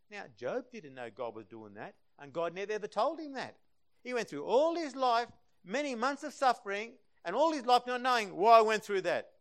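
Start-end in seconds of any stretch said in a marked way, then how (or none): 2.2-9.3 loud, high preaching about suffering; full-force preaching. Acted. Preacher to congregation on Job's suffering, in full-force preaching.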